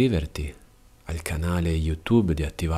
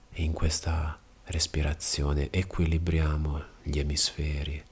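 calm yoga instructor guiding breath, with long pauses; measured bedtime breathing guidance, unhurried pauses between phrases; guided meditation; 3 dB quieter than usual. Real, not acted. Yoga teacher preparing listener for restful sleep, guided meditation.

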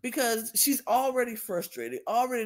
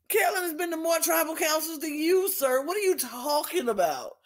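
higher-pitched, incredulous comeback; indignant retort; 8 semitones higher than usual. Retold or imitated. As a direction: fire back in disbelief, pitched up. Retelling an indignant retort to host.

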